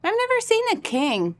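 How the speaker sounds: put-on chirpy young child introducing themself; little kid voice. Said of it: "little tow-headed kid".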